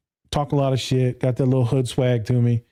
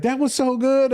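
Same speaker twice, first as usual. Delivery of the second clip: much higher imitated woman; impressed lady; 12 semitones higher than usual. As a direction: voice the lady's praise, high. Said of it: "the one lady was like".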